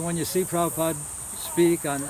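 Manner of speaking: ordinary delivery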